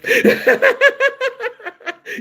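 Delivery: high-pitched burst of laughter; high giggle. Real, not acted. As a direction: cackle high at the comment. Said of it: "laughs at comments".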